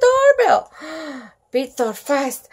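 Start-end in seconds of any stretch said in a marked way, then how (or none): none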